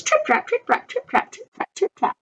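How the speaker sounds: high quick steps fading into a whisper; fading trip trap. A narrator's reading. Goat running across the bridge, steps fading away. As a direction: let the hoofbeats run off into the distance.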